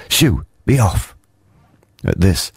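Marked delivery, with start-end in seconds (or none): 0.1-1.2 high slow shooing call; shouting shoo. A narrator's reading. Snufkin shouting at the creep to leave. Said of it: "clapped his paws together and shouted".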